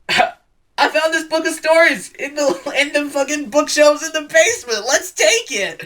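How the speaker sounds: mock girl voice breaking into laughs; laughing girl voice. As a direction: laugh your way through the discovery. Girl child quoted, laughing through it.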